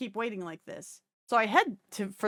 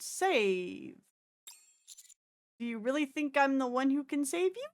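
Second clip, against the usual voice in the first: ordinary talk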